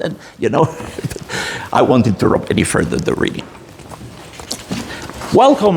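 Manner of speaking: ordinary talk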